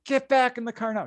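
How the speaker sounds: high urgent command; high urgent quote of a scared friend; panicked order. Retold or imitated. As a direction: order him back now. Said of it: "she's like".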